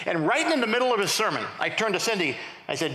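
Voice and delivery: ordinary talk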